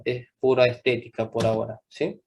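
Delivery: usual speaking voice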